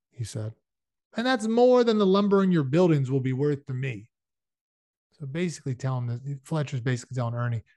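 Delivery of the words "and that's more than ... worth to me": higher, louder hard bargain; mildly dismissive bargaining. A narrator's reading. Fletcher belittling Ernie's buildings, mildly dismissive. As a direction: push the lowball offer, voice raised.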